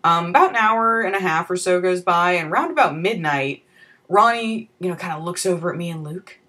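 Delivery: ordinary delivery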